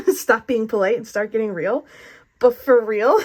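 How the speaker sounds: loud, laughing imitation of a TV tagline; MTV Real World intro. Reader quoting the show she loved as a kid, mock intro. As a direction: belt it out like a playful show opener. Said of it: "giving a little, like, M T V Real World".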